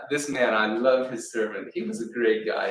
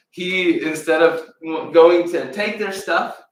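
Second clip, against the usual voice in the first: emphatic; 4 semitones higher, 7 dB louder than usual. A narrator's reading. Storyteller emphasizing change of heart.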